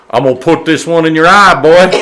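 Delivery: put-on high, loud country-accented trash talk; hollered country twang, played for laughs; Larry Bird's country accent. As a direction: taunt loudly in a mock country accent. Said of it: "has this country-ass accent".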